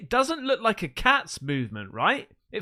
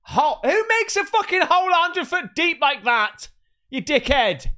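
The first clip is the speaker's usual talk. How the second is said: incredulous angry rant; 5 semitones higher, 4 dB louder than usual. Real, not acted. Outraged at the deep hole.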